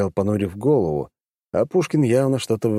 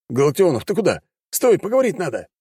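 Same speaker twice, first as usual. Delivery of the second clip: calling out; 10 semitones higher than usual. A narrator's reading. Pushkin calling after hero.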